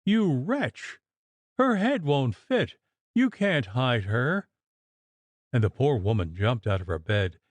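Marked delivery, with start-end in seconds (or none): 0.1-4.5 understated scream. Acted. Mistress crying out at a vision, a mild scream. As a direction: cry out in horror at the vision. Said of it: "uttered another terrible scream, crying".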